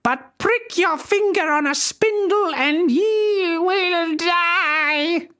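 high, slow shrieking witch curse; fairy curses the baby before the court; screamed curse. Acted. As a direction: hurl the curse down at the cot. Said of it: "she screamed at the top of her lungs".

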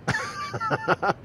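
high squealing laugh; short shrill giggling fit. Real, not acted. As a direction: burst into high-pitched laughter. Laughing at his own remark.

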